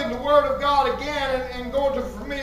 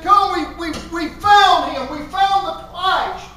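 higher, louder, thrilled proclamation; excited testimony; 4 semitones higher, 5 dB louder than usual. Acted. New converts announcing they found the Christ, excited and loud. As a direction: burst out with the great discovery. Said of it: "if I can get excited about".

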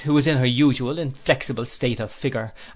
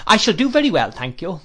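put-on a touch of an imperious old lady, higher; stern aunt, lightly voiced; 6 semitones higher, 6 dB louder than usual. A narrator's reading. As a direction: scold the nephew briskly. Aunt rebuffs Mrs Crupp.

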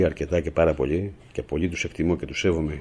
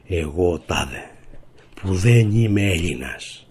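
slow, mocking recital of a traitor's oath, louder at the end; mock oath reading; 5 dB louder, much slower than usual. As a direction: read it out like a scornful parody of an oath. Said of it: "θα βγει ένα κείμενο που θα λέει". (κοροϊδευτική απαγγελία όρκου)

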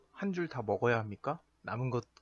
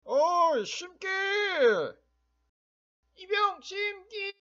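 calling out; 5 dB louder, slower than usual. Acted. Leader calls the private.

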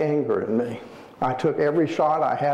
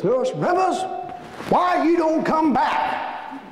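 imitating an old man calling out in high-pitched complaint; old man pleading; 11 semitones higher than usual. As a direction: wail the question, stretching it out. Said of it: "he says to her".

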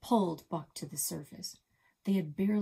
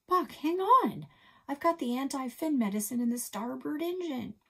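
urgent plea; 9 semitones higher, 6 dB louder than usual. A narrator's reading. Chase begging wounded Buck to hang on, high and urgent. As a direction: cry out to save him.

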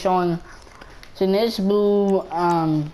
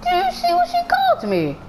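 mock outrage. Player reporting a character's insult, mock outrage. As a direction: squeal as if scandalized.

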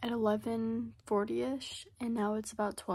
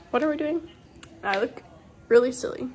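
ordinary delivery

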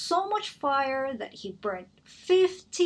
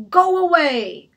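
angry dragon; 3 semitones higher, 9 dB louder than usual. Acted. The dragon yelling at Elizabeth to go away, roaring. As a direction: shout her away from the door.

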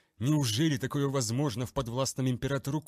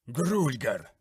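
louder, spat out like a curse; cursing shout; 3 semitones higher, 5 dB louder than usual. Acted. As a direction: hurl the name as a curse. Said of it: "Имя, словно проклятие, сорвалось с губ". (выкрик, как проклятие)

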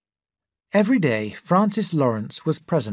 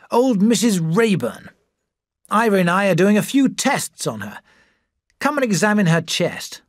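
a lightly voiced sly society doctor, higher; smooth Freddie; 6 semitones higher than usual. A narrator's reading. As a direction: slick persuader tempting a friend.

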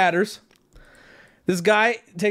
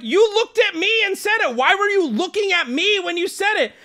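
high-pitched indignant retelling; feral outburst; 9 semitones higher than usual. Retold or imitated. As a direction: act out an affronted tirade, pitched way up. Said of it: "I go feral".

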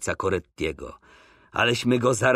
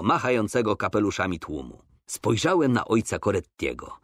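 plain reading voice

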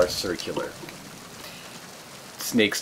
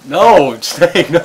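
loud laughing no; laughing shout; 6 semitones higher, 12 dB louder, slower than usual. Real, not acted. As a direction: yell it out while laughing. Reacting to player's choice.

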